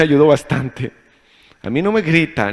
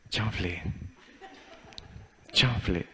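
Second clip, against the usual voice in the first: much softer, lower defeated muttered word; stunned mumble; 5 semitones lower, 13 dB quieter, slower than usual. Retold or imitated. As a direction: mumble it, cornered and speechless. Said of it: "se queda así de".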